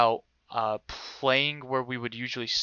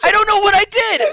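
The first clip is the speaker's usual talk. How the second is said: very high, shouted comic wail; mock crying; 12 dB louder than usual. Student protests he did nothing, mock crying. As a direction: wail it at the top of your voice, hammed-up. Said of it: "I'm starting to cry".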